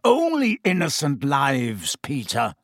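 plain reading voice

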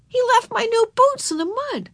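put-on very high child's voice; upset little girl. A narrator's reading. Girl complaining to teacher about lost boots, plaintive.

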